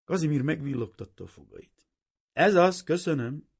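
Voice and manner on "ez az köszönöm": high and loud, pleased with himself; delighted. A narrator's reading. Kazimír delighted by the girl's voice, high and loud. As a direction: savour the moment.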